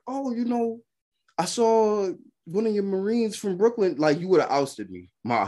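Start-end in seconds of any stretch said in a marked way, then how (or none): 0.1-4.0 higher, mock-innocent wife voice; lightly mocking wife. The wife telling her husband about a Marine, in a lightly raised mock voice. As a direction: imitate her casually tattling. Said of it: "she couldn't just be like".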